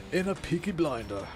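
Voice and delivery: mock Peaky Blinder Birmingham accent; bad Birmingham accent. Shown as a demonstration. Streamer channelling inner Peaky Blinder, bad Birmingham accent. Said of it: "I have to channel my inner".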